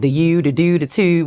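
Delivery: fronted Baltimore vowels in "you", "do", "two"; Baltimore accent. Shown as a demonstration. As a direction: show off the Baltimore accent on each "oo". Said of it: "we do have a strong accent".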